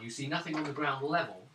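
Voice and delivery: usual speaking voice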